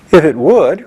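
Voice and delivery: plain reading voice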